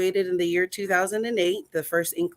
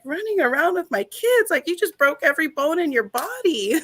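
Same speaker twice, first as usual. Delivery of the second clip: crying disbelief; 9 semitones higher, 3 dB louder than usual. Retold or imitated. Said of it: "like, crying".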